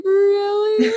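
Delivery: light up at the question; excited. Retold or imitated. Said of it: "the lady got so excited".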